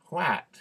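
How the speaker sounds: WHAT with the short "a" of "hat"; spelling pronunciation. Shown as a demonstration. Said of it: "you would expect that to be".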